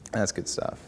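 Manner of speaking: ordinary talk, nothing marked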